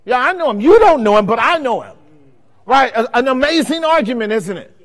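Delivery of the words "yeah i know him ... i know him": emphatic Jesus. Retold or imitated. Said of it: "That's what Jesus said".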